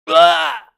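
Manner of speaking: high loud dying yell; death groan. Pretend. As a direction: cry out as the hero dies. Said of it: "here's my dying sound effect".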